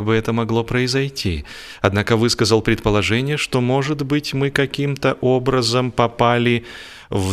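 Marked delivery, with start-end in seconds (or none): none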